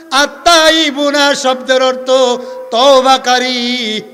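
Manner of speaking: high, sustained preacher's sing-song; measured chanting to a congregation; intoned sermon teaching. Acted. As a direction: announce each point on a held note. Preacher intones his teaching point.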